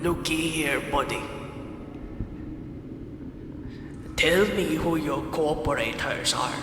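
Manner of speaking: Scottish sliding into pirate; pirate accent. Acted. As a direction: growl it like a hammy pirate interrogator. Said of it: "It's turned into a pirate accent".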